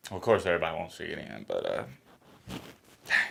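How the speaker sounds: normal talking voice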